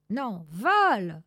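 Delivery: loud, slow command to fly; urging call. Acted. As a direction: push her off with a loud urge. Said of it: "dit Zorba". (ordre lancé fort et lent)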